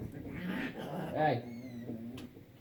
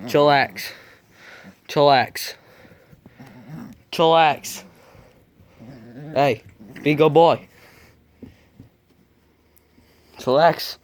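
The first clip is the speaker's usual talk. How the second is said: louder, firm commands to a puppy; firm scolding; 5 dB louder, slower than usual. Real, not acted. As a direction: tell the puppy off, firmly but fondly.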